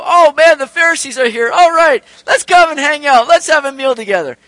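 loud, high, sarcastic delight; mock eagerness. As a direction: ham up fake enthusiasm for the Pharisees. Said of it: "Did sinners, like, go".

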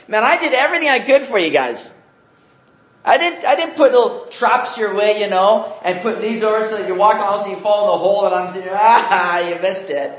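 God as a mock trickster. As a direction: play a mock-cruel God who laughs at the fall, in a high voice. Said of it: "In other words, God's saying".

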